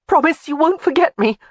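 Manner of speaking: high, urgent plea; desperate not to be forgotten; frantic edge. A narrator's reading. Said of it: "a frantic edge in her voice".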